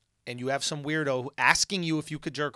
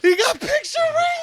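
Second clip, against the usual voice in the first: squeaky repeat; 6 dB louder than usual. Real, not acted.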